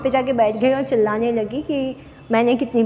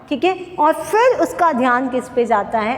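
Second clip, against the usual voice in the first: normal talking voice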